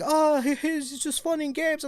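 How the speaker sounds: high, slow mock African accent with a laugh; friend laughing it off. Friend shrugging off the animal tape, in a slow high mock accent with a laugh. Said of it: "he's like".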